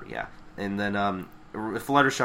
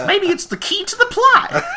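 very high, laughing squeal; hammed-up character voice. Host riffing as Applejack, squealing laughing character voice. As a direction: squeeze it out through laughter.

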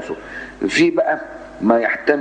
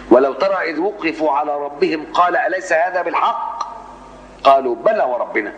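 measured chanted Quran recitation, a bit higher; 4 semitones higher than usual. Shown as a demonstration. Teacher reciting the verse to the class in measured recitation.

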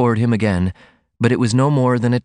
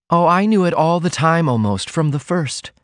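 higher-pitched heroine, confiding; heroine in love; 8 semitones higher than usual. A narrator's reading. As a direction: voice a woman admitting her love. Heroine answers her lover's question.

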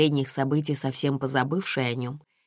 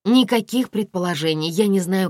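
higher, louder insistence; hasty denial; 5 semitones higher, 5 dB louder than usual. A narrator's reading. Aglaya fends off the investigator's question, hasty denial. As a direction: deny it hurriedly, pitch raised. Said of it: "поспешно сказала Аглая".

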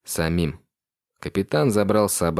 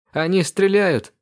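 much higher, slow frightened cry; near scream; 12 semitones higher, 4 dB louder than usual. Acted. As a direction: panic as the enemy fires. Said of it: "Дрея почти сорвалась на крик". (испуганный, почти крик)

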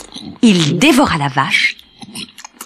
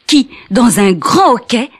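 ordinary delivery, nothing marked

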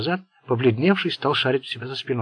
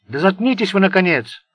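loud, higher outburst; snapping into a shout; 7 semitones higher, 6 dB louder than usual. A narrator's reading. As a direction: lose it and shout. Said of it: "сорвался на крик Сеймур".

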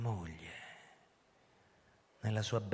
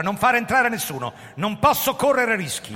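loud, higher urgent command; raised urgent voice; urgent orders; 13 dB louder than usual. Acted. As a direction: bark the orders with no time to waste.

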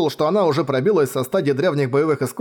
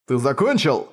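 plain reading voice

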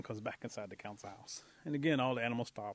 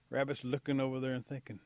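plain reading voice

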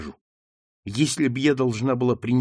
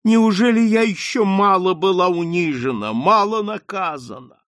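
high, loud anguished lament; sobbing lament; 7 semitones higher, 5 dB louder than usual. A narrator's reading. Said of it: "рыдая".

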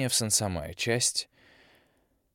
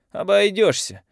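high, loud defiant retort; 13 semitones higher, 10 dB louder, slower than usual. Acted. Girl refusing the young man, loud defiant retort. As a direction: fling it back with chin up.

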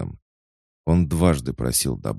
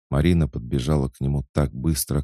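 ordinary delivery

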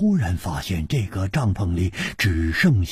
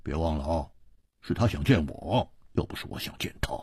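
low, unhurried start fading to a near-whisper; leisurely; 7 semitones lower than usual. A narrator's reading. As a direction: toss it off at leisure, letting the voice sink away. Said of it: "悠然说". (悠然低语，越说越轻)

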